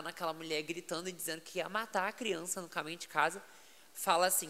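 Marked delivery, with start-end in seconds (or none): none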